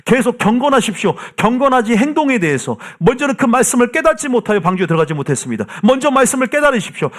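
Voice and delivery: urgent, high-pitched exhortation; full-force preaching. Real, not acted. Preacher urging people to grasp the Word, in impassioned exhortation. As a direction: drive it home to the congregation, pitch held high.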